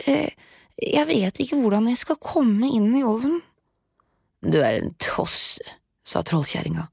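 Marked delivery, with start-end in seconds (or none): 0.1-3.4 put-on high, innocent girl voice; playing dumb. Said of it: "svarte hun". 4.4-5.6 lightly voiced impatient witch; scolding witch.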